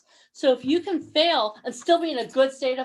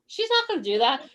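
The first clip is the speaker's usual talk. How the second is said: ordinary talk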